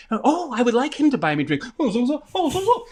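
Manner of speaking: hammed-up fake foreigner. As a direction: play the royal visitor who barely speaks English. Fake royal answering through translator.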